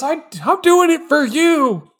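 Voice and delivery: an overwrought dad pleading; mock anguished dad. Dad screaming at his child that it's for them.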